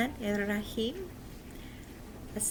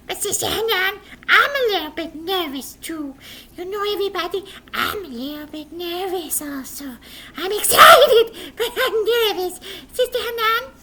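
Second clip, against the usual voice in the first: put-on high puppet boy, a touch nervous; small worried puppet voice; 10 semitones higher, 11 dB louder than usual. Puppet boy admits nerves to his teacher, high.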